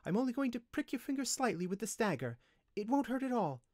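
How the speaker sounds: high-pitched, gentle character voice; gentle reassurance. A narrator's reading. Windling about to prick the slave's finger, gently. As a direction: reassure her sweetly, up high. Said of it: "she said gently".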